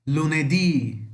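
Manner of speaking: slow "lunedì", leaning on the final ì; Italian "lunedì", drawn out on the last syllable; final-syllable stress. Shown as a demonstration. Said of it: "Sempre a última sílaba mais forte". ("lunedì" devagar, com a última sílaba forte)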